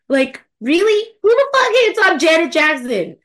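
high, loud disbelief; indignant, outraged questions. Real, not acted. Outraged about Janet haters.

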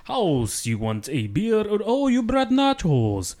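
a Dracula-like drawl passed off as Mexican, slow on the welcome; Transylvanian-sounding Mexican. Acted. Actor greets his friend at the door in a wrong Transylvanian accent. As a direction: play a Mexican host but it comes out vampire. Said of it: "That was a Mexican".

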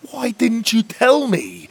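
slow, high, loud, stress on tell; hurt fairy huffing at the boy; huffy reproach. Acted. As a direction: demand why he didn't tell. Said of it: "Mags huffed".

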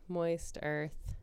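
normal talking voice

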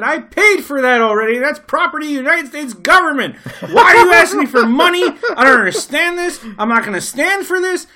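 hammed-up indignant tourist with a laugh; indignant American. Young American arguing with passport clerk, hammed-up outrage. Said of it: "And I said".

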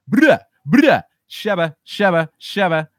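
loud, high hype ad-libs; hype shout. Real, not acted. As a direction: bark out the ad-libs like a hype man. Hype shout after rant.

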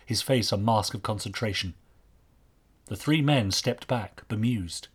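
usual speaking voice

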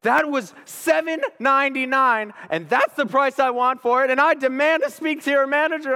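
mock irate customer voice; demanding customer. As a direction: demand the manager like an angry shopper. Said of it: "she's like".

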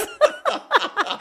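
a real high laugh. Real, not acted. Wife laughing at her husband's odd memory, high laugh.

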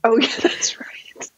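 usual speaking voice

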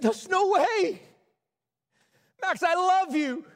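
pleading tenderly with his son; father reassuring his son, slow and high; emotional reassurance. Retold or imitated.

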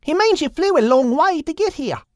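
high Aussie lizard voice; chirpy and high, helping out; high Australian lizard, explaining; Itchy the lizard. Acted.

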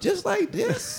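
slow, high and laughing; high incredulous question. Retold or imitated. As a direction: drag it out in disbelief. Confused in the bathroom.

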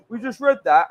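mock northern accent. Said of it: "I don't say it like that".

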